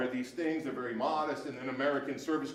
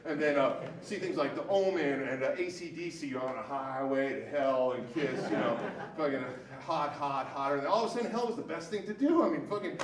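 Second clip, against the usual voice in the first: ordinary delivery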